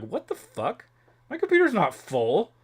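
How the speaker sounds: baffled, pitch leaping high; mock disbelief. Finding the computer's memory full, retold disbelief. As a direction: replay being utterly thrown, voice shooting up. Acting out own baffled reaction.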